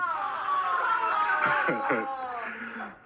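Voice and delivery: a long, high, loud burst of laughter; real laugh at his own punchline. Real, not acted. Big laugh at own joke.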